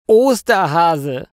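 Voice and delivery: slow, high, loud exclamation; calling out. A narrator's reading. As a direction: call out your rival's name, drawn out. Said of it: "ruft der Mann im Kostüm".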